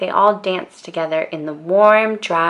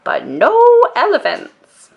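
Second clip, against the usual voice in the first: "no" higher, louder and falling; firm no elephants; 9 semitones higher, 5 dB louder than usual. A narrator's reading. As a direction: stress the word "no" hard, then let "elephants" drop. Grandma's refrain.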